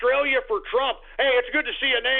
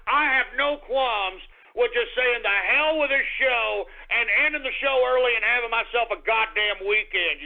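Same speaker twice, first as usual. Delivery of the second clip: ordinary delivery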